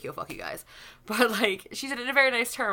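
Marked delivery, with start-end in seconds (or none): 0.0-0.6 unvoiced, much softer brush-off; whispered mock retort. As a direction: hiss it out under your breath. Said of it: "I would be like".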